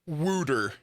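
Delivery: "wooter" with an oo in place of the aw; "wooter", drawn-out oo vowel; regional pronunciation. Shown as a demonstration. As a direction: say "wooter" for water, Philly style. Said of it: "this is the word".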